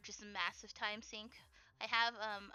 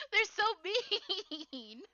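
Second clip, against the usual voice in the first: very slow, laughing through it; laughing, drawn out; 7 semitones higher, 4 dB louder, much slower than usual. Real, not acted. Fan laughing to viewers about mean anime boys, very slow laughing. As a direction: stretch it out while giggling.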